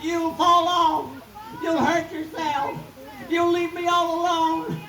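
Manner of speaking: high, drawn-out pleading holler; mama yelling. Acted. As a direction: wail a panicked warning up high. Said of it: "her mama started yelling".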